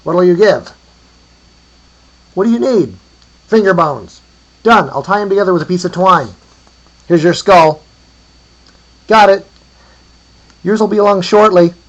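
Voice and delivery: high, loud back-and-forth hollers; calling across distance. A narrator's reading. Two traders swap skull and finger bones, calling out. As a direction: shout the bargain so it carries.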